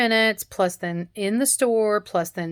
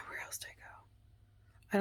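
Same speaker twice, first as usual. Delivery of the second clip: slow, whispered to herself; a guilty little whisper; sheepish whisper; 19 dB quieter, slower than usual. Real, not acted. Sheepish about spending.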